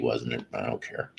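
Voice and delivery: normal talking voice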